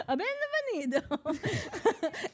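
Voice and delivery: high, drawn-out fake Italian accent; sing-song Italian accent, hammed up and laughing; mock Italian accent. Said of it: "I get hired for my accents".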